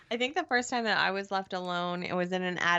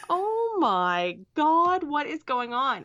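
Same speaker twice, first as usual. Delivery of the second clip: high, slow, alarmed wail; mock panic; 7 semitones higher, 4 dB louder, slower than usual. Locked in the vault, panicking at Holly. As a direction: play the panic of being locked in. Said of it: "And I'm like".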